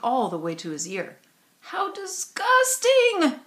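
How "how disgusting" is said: high, slow, drawn-out screech. A narrator's reading. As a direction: shriek it out in revulsion. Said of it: "screeched Ramona".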